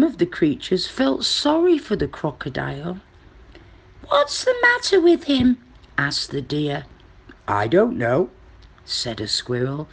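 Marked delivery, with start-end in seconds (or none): 4.1-5.6 very high-pitched deer character. Acted. Narrator voices the deer. 7.5-8.3 low-pitched squirrel character. Acted. Narrator voices the squirrel.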